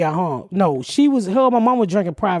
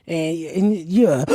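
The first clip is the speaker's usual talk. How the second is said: ordinary delivery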